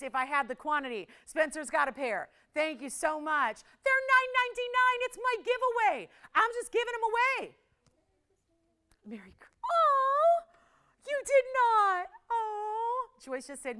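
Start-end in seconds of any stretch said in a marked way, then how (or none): 3.8-7.6 excited. Real, not acted. Excited giveaway pitch to buyers. 9.6-13.1 high squeal of delighted surprise; a shocked, thrilled oh; surprised cry. Real, not acted. Surprised by a viewer's message.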